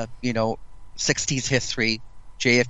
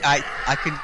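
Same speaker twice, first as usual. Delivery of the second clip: ordinary talk, nothing marked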